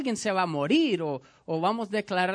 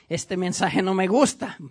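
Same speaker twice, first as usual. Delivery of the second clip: grumbling churchgoer; 3 semitones lower than usual. Pretend. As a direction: grumble like a member who dislikes the sermon. Pastor voices a displeased churchgoer.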